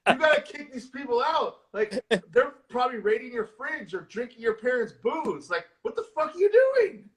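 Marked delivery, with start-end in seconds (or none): 5.8-7.1 much higher, exasperated outburst. Retold or imitated. Host yelling at the sister on screen, exasperated. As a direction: throw your hands up in disbelief. Ranting at movie character.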